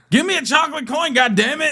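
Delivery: high mock-bossy boy's demand; kid demanding. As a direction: bark it like a greedy kid. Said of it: "Sean's like".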